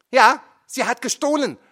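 very high, loud retort; defiant snap. A narrator's reading. Mother snaps back at her son. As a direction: spit it back.

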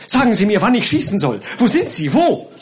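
high, agitated, climbing demands; frantic excitement. A narrator's reading. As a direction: demand it wildly, voice rising to the last word. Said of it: "in höchster Aufregung".

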